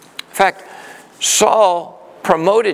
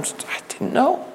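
whispered, softer and slow; stunned whisper; 9 semitones higher, 7 dB quieter, slower than usual. Acted. Jonathan admitting to David he had no idea, whispered. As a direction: let it out barely voiced, shaken. Jonathan returns, shaking his head.

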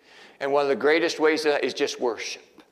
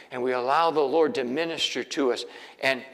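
usual speaking voice

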